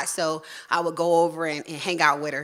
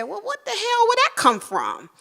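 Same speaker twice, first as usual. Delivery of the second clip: indignant surprise. Retold or imitated. Repeating outrage at mother.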